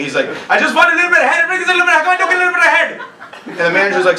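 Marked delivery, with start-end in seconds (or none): 0.5-3.0 put-on high-pitched, exasperated rant in accented English; frustrated accented dad. Frustrated dad pleading with the manager, exasperated accented outburst. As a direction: imitate dad losing patience, voice jumping way up. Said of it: "my dad's just frustrated at this point".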